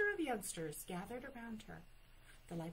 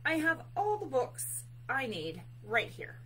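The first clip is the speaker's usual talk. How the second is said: loud, raised, insistent bear; emphatic announcement; 5 semitones higher, 7 dB louder than usual. A narrator's reading. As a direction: declare it firmly to end the matter. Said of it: "Bear announced".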